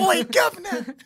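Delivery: high, loud Cockney-style holler; mock British accent. Friends trading bad accents, shouted mock British. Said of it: "watch a bunch of British T V shows".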